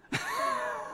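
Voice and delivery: soft high giggle; a quiet delighted high-pitched laugh. Real, not acted. Preacher giggling at Jesus walking past the boat.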